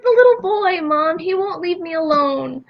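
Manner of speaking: imitating a whiny little girl, high; tearful child pleading, high and slowed; upset child. Mother voicing her daughter pleading about the ghost boy, high and whiny.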